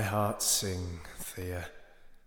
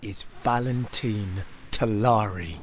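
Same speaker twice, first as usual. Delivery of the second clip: grand, swelling storyteller voice; epic narration; 6 dB louder than usual. A narrator's reading. Narrator tells his people's history, epic and proud. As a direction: tell the legend proudly.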